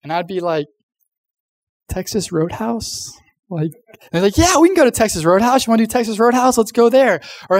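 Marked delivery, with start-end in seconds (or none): 1.9-3.1 soft, slow, tentative suggestion with a questioning rise; doubting, hopeful. Retold or imitated. Kid timidly answering parents about where to eat, doubting but hopeful. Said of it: "doubting, like, as if". 4.3-7.2 high, eager imitation of agreeing parents; excited. Parents agreeing to the kid's restaurant pick, excited. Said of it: "they'd be all excited".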